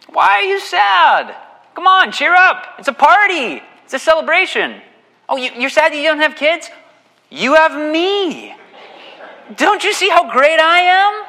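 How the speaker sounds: very high, loud, hammed-up smug husband; mocking oblivious husband. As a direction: lampoon a smug husband cheering his grieving wife. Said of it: "how not to respond to your wife".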